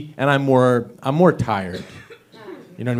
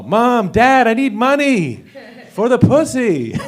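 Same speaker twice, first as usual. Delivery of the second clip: high, loud begging son; pleading son voice; 9 semitones higher, 4 dB louder, slower than usual. Retold or imitated. Son calling his parents for money, high and pleading. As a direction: whine it up high to your parents. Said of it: "That's always an embarrassing call".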